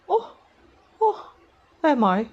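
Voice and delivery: high put-on "oh", dropping on "am I"; awkward attempt at a high villainess voice. Shown as a demonstration. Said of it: "my attempts to say this phrase".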